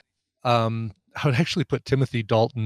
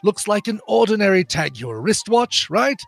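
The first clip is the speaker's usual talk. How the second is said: raised, fussy, exasperated quartermaster; John Cleese as Q; 4 semitones higher than usual. Acted. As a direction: primly lecture the careless agent. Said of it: "I'm doing the John Cleese".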